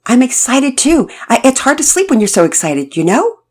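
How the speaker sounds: put-on eager teen who can't sleep for joy; Todd, excited.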